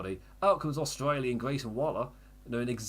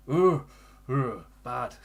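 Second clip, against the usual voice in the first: mock disgust; 2 semitones higher, 9 dB louder, much slower than usual.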